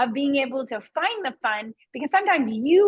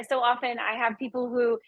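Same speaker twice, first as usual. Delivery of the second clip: ordinary delivery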